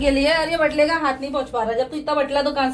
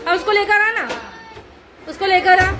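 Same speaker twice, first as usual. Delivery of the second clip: 1 calling out; 2 semitones higher than usual. Real, not acted. Calling someone to bring child.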